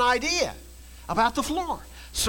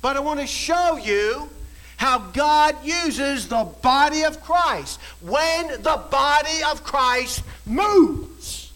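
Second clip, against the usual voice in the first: ordinary talk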